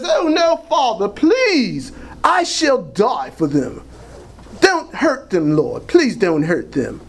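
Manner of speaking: hammed-up, drawn-out British pleading; movie Jesus British accent. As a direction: beg slowly in a mock British accent. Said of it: "would be speaking with a British accent".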